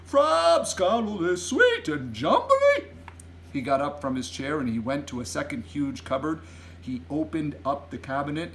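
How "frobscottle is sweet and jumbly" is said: loud, very high, stretched-out protest; gleeful high bellow, drawn out; giant's exuberant cry. A narrator's reading. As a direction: sing its praises in a big giant voice. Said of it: "cried the B F G".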